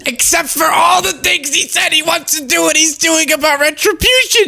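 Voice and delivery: angry shout. Real, not acted. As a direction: yell it out in disbelief. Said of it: "I'm so mad right now".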